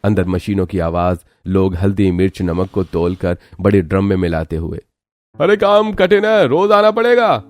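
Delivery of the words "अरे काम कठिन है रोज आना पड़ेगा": factory supervisor. Acted. Factory man warns Neha.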